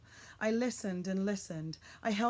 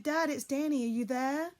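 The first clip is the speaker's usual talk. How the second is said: loud calling, higher pitched; shouting; 7 semitones higher, 7 dB louder than usual. A narrator's reading. Boy searching the night wood for his father, shouting. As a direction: call through the dark woods. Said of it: "I shouted".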